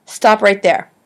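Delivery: sharp interrupting stop; cutting in. A narrator's reading. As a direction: stop him mid-sentence. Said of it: "Savannah interrupted".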